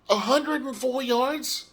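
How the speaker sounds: high, slow disbelieving question on hundred; can't believe the number, slowly rising; incredulous question. Real, not acted. Reading his stats in disbelief.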